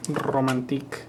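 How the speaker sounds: Romantique said the French way, ending in a soft "teek"; French pronunciation. Shown as a demonstration. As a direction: say Romantique like a Frenchman, stress on the last syllable. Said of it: "This is his beautiful romantic album".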